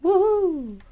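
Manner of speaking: high, loud whoop of nervous bravado; excited whoop. Real, not acted. Gamer whooping to shake off fear. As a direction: whoop to psych yourself up. Cheering to brave the dark.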